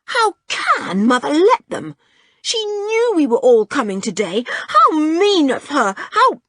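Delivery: high, loud, wailing complaint; outraged. A narrator's reading. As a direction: build to an indignant cry. George outraged about unwanted guests.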